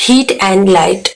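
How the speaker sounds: "heat and light" read very slowly, word by word, as dictation. Shown as a demonstration. Teacher reads the sentence slowly to students, dictating. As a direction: spell out "heat and light" slowly for the class to copy. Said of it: "जो सन है वो हमें क्या देता है?".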